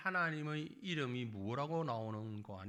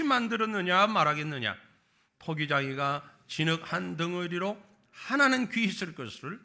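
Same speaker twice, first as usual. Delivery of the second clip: much louder, higher solemn recitation; reading scripture aloud; 5 semitones higher, 9 dB louder than usual. Real, not acted. Congregation reading Romans aloud with the preacher, loud and solemn. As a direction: proclaim the verse together in full voice. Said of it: "우리 다 같이 소리내서 같이 읽겠습니다".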